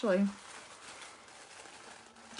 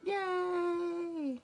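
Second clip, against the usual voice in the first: cheering; 10 semitones higher than usual. Real, not acted. Cheering that viewers arrived.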